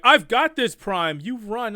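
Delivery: loud, high heroic call; rally like a hero staying behind; heroic sacrifice. Retold or imitated. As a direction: Skylynx telling Prime to run, heroically loud. Skylynx's heroic last stand.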